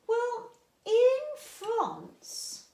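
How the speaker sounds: very slow, high drawn-out reveal; teasing build-up. Acted. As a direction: stretch it out to build suspense.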